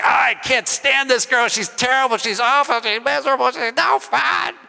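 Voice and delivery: high-pitched, hammed-up child's whine; whiny kid complaining. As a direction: imitate a kid grumbling to the parents. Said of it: "I grumbled and complained about it".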